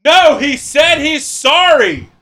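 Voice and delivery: high mock yell repeated; mocking kitchen shout. Cooks shout the apology back and forth, mocking. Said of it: "and then you hear".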